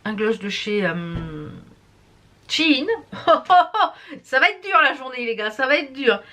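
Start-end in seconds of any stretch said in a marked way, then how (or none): 2.9-4.2 high burst of laughter; self-amused laugh. Real, not acted. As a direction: laugh at your own fumbling. Laughs at own fumbling for words.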